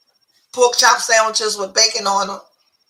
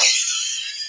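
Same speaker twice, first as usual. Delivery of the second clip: breathless laugh; 9 dB quieter than usual. Real, not acted. Cohost's bread joke.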